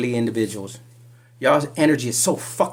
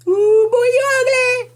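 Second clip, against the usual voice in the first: much higher, louder mocking squeal; high loud ooh-boy jeer; sassy taunt; 9 dB louder, slower than usual. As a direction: taunt him like a gossiping auntie. Mocking insult to the accused.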